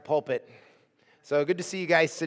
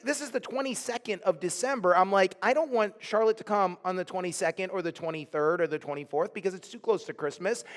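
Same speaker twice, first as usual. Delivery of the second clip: ordinary talk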